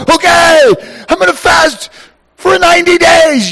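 high, loud eager announcement; eager declaration. Retold or imitated. Husband proudly announcing a long fast, eager. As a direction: announce the vow with zeal. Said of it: "I think".

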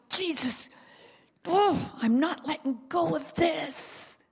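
groaning prayer. Acted. Believer praying to Jesus in the secret place, groaning plea. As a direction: plead it from deep in the gut. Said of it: "deep groaning. It's like".

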